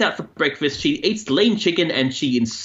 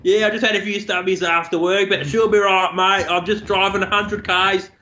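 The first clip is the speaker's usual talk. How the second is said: higher-pitched broad Aussie Bogan; friendly Bogan accent; 4 semitones higher than usual. Shown as a demonstration. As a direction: chat like a friendly Bogan to a mate. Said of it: "my Bogan talk is very G-rated. It's very friendly".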